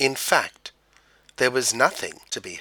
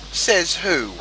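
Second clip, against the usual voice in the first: angry; 7 semitones higher, 4 dB louder than usual. A narrator's reading. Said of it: "said the frogs angrily".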